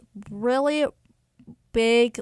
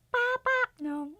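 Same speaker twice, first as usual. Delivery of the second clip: high cartoon babble; Minion voice; 14 semitones higher than usual. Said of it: "Can you do a Minion impression".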